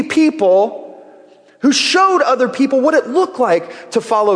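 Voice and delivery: preacher rising to the point of the sermon; building preaching. Acted. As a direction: drive it home from the pulpit.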